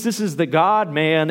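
ordinary talk

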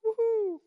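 high loud carefree whoop; mock cheer whoop. Preacher mocking denial with a loud high whoop. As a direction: whoop like nothing's wrong. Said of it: "Like the video".

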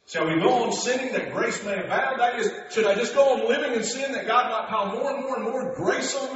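high, loud, driving sermon climax; preaching at full force. Acted. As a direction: build the fire of the pulpit.

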